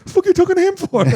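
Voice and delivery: imitating the kid, very high and squeaky-blunt; blunt kid's retort again. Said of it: "He goes".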